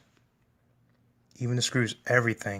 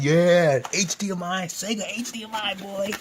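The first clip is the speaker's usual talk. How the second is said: excited imagined cheer; 7 semitones higher than usual. Retold or imitated. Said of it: "I'd be like".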